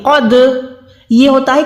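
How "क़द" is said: क़द with the final d released in an echoing bounce; qalqalah. Shown as a demonstration. Teacher contrasting the right way, qalqalah echo on क़द. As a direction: make the द of क़द vibrate back after closing. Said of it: "एक vibration आ रही है".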